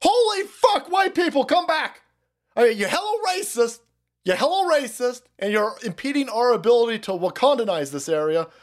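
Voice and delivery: high, frantic mock begging; mock desperate high plea; hammed-up pleading. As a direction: beg the farmers back in panic. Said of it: "And they said".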